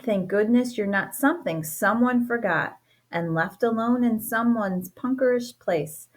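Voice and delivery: ordinary delivery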